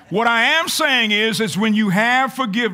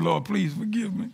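laughing prayer; 3 semitones higher, 7 dB quieter than usual. Real, not acted. Preacher confessing his shout to the church, laughing. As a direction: laugh through the plea for forgiveness.

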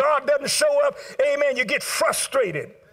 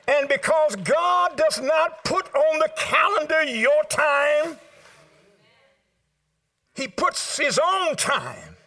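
preaching shout; 4 semitones higher, slower than usual. Real, not acted. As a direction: lift it up and shout it out. Preaching climax.

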